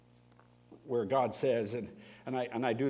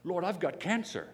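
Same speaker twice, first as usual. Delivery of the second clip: mock demanding prayer; 8 semitones higher, 5 dB louder than usual.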